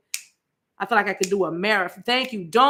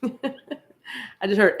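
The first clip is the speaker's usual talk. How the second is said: laughing through the words; real giggle at a chat comment; 2 semitones higher, much slower than usual. Real, not acted. Preacher laughing after reading a viewer's comment.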